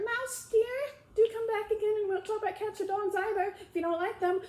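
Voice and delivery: high, gentle pleading call; soft calling. Acted. Alice softly calling the mouse back, gentle. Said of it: "called softly after it".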